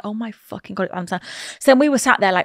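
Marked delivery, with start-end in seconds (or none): 0.0-1.2 soft, low, hushed gasp of disbelief. Retold or imitated. Boyfriend spotting Adam Sandler at breakfast, hushed. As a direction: hush it so he can't hear. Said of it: "I was like".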